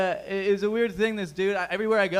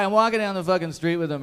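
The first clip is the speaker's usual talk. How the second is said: usual speaking voice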